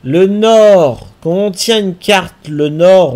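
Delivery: loud, sudden insight; excited realisation. Real, not acted. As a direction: say it like a eureka. Realises the map logic.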